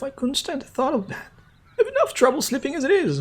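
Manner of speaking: a hint of a worried villager who can't sleep; fretful villager. Voicing the grateful villager's worry, soft high fretting. NPC dialogue read in a voice.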